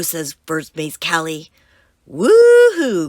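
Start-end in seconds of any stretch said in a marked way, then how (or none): 2.1-3.1 high, loud woo-hoo cheer; cheering crew. Acted. The crew cheering as they set sail, high and loud. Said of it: "cries the crew".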